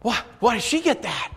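high, whining protest; whiny kids. Retold or imitated. Pastor voicing siblings jealous of the toy, whiny. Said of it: "the other kids are like".

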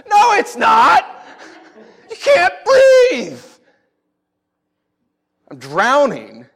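high, loud, slow panicked wail; panicked drowning cry. Retold or imitated. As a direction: cry out like you're going under. Acting out panic while drowning.

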